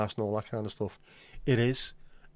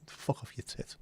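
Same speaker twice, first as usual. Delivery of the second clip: hushed muttered insult; a whispered swipe at his mate; whispered aside; 11 dB quieter than usual. Real, not acted. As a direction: drop to a whisper for the jab. Muttered aside about friend.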